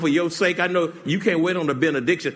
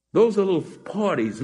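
ordinary talk, nothing marked

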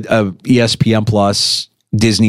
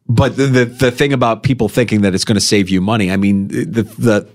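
normal talking voice

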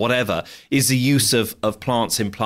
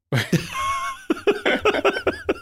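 big laugh. Real, not acted. Laughing at own joke.

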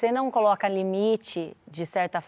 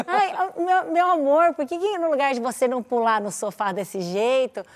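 sweet mom voice; 3 semitones higher than usual. Retold or imitated. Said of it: "você vai substituindo". (mãe doce convidando a filha para a cama elástica)

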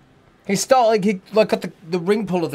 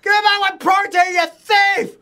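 retold shout; 14 semitones higher, slower than usual. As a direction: yell up at the thieving bird on the roof. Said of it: "And I was there going".

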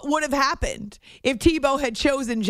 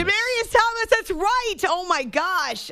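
high-pitched burst of delighted recall; excited recognition; 8 semitones higher than usual. Real, not acted. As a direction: exclaim it as the forgotten name clicks. Reminded of the forgotten receiver's name.

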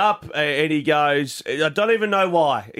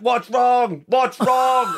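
put-on kid yelling; 8 semitones higher, much slower than usual. Dad imitates his son yelling from the other room. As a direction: call out like a worried five-year-old. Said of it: "Ted's yelling out".

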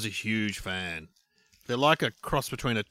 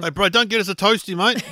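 bad Kiwi accent put on; hammy New Zealand twang; 8 semitones higher, 6 dB louder than usual. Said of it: "bad New Zealand accents".

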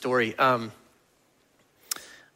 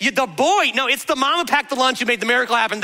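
usual speaking voice

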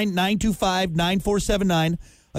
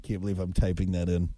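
muttering to himself; 11 semitones lower, 6 dB quieter than usual. Real, not acted. Muttering while typing the search.

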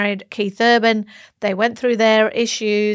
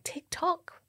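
mock ominous whisper; 8 semitones higher, 12 dB quieter than usual. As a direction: whisper it like a countdown. Whispered tick-tock gossip.

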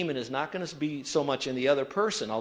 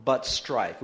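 slow, measured group reading; read aloud together; 2 semitones lower, much slower than usual. Real, not acted. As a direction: lead the congregation word by word, slowly. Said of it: "read it out loud with me".

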